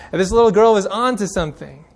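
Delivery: normal talking voice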